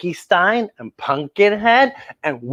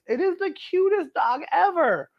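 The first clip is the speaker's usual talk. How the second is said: gushing; 12 semitones higher, 5 dB quieter than usual. Real, not acted. Gushing over picture.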